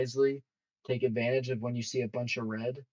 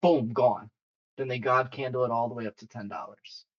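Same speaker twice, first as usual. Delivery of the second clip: ordinary delivery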